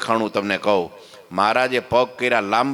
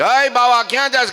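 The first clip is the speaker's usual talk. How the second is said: loud challenge; 12 semitones higher, 5 dB louder than usual. Retold or imitated. Husband confronting sadhu on veranda.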